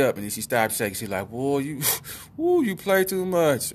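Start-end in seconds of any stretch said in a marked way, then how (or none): 1.3-3.7 high, drawn-out mock relief; relieved dog voice. Voicing relieved dog picked back up.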